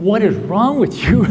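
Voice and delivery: tearful self-scolding. Retold or imitated. Retold sobbing, scolding himself.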